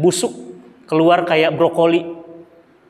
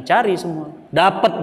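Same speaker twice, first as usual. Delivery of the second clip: ordinary delivery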